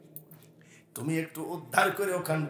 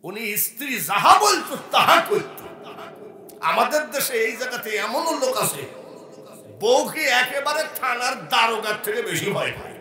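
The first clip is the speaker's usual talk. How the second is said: raised, forceful sermon delivery; loud rousing preaching; 7 semitones higher, 10 dB louder than usual. Acted. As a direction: play it up loudly to the crowd. Preaches loudly to the crowd. (চড়া গলায় জমিয়ে ওয়াজ)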